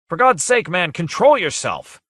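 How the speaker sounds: high, loud urgent shout. Acted. Captain Bussey holding back C, loud and high. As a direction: yell him down.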